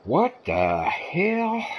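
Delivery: drawn out, high and slow disbelief; baffled. Real, not acted. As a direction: stretch it out in total confusion. Baffled at a game glitch.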